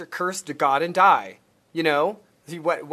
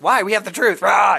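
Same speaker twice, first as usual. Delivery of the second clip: zealous battle cry; 4 semitones higher, 7 dB louder than usual. Retold or imitated. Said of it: "And I was like".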